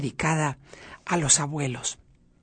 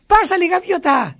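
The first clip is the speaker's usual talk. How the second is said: bright raised call to come in; 8 semitones higher than usual. Real, not acted. Host calling Gaviota onto the show, raised welcoming call.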